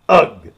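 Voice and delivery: a loud disgusted shiver of a grunt; shuddering ugh. A narrator's reading. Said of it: "with a shiver".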